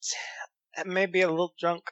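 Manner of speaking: tipsy, halting character voice; drunk voice. Acted. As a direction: stumble over the words, a little drunk. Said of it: "trying to figure out what his drunk voice was".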